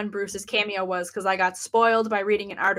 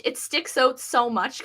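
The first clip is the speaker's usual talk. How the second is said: normal talking voice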